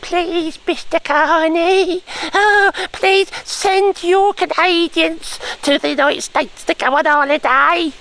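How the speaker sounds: high, drawn-out pleading wail; mock begging. Presenter mocks Trump begging Canadians to visit, mock begging. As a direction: plead in a slow, exaggerated high voice. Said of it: "Trump is now begging".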